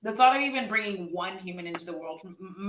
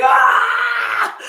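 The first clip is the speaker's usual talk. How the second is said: mock scream, much higher and louder; screaming back; 14 semitones higher, 14 dB louder than usual. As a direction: scream right back at the toddler. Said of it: "I'd be like".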